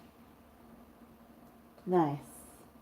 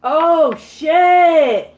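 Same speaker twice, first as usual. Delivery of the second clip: loud, high startled swear; shocked outburst; 8 semitones higher, 14 dB louder than usual. Real, not acted. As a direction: blurt it out, caught off guard. Player reacts to Carmen's outburst.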